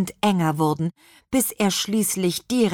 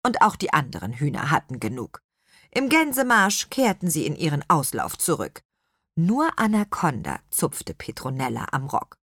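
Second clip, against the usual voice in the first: ordinary delivery, nothing marked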